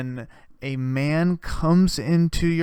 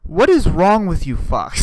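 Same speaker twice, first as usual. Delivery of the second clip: loud outburst; 4 semitones higher, 8 dB louder than usual. Real, not acted. Outburst at audience.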